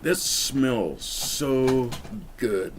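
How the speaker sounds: very slow, drawn out savoring; savoring slowly. Shown as a demonstration. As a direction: stretch it out while breathing in the aroma. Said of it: "Let me do it again so you know what it is".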